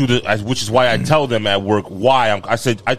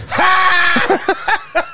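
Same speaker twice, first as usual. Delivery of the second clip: a sharp, much higher shouted "Ha!" mocking the boss; mocking laugh shout. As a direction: bark out a gloating high "Ha!". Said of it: "He goes".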